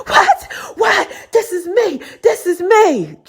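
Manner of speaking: high, outraged mock of the caller; mock shrill caller. Mimicking the other woman's outrage on the phone, in a high squeak. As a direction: squeak out her indignation, mocking her. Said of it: "She was like".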